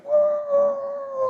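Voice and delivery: long, very high wail; high swerving whoop. Retold or imitated. Driver swerving the car around, long high whoop. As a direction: whoop through the whole swerve.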